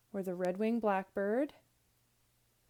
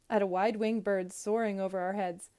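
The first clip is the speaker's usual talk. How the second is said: ordinary delivery, nothing marked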